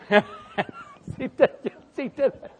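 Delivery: high breathless giggling; helpless laughter. Real, not acted. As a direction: laugh until words barely come out. Laughing too hard to talk.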